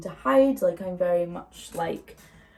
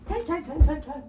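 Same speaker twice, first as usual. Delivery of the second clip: sing-song; 8 semitones higher, 3 dB louder than usual. Real, not acted. Checking the clock playfully.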